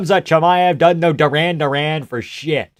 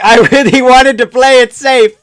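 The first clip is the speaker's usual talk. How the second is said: mock fighter; 9 semitones higher, 9 dB louder than usual. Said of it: "why are we like".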